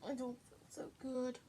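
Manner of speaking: soft, quick, low mumble; quiet and fast. Shown as a demonstration. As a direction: say it softly and quickly. Quiet fast take, repeated line.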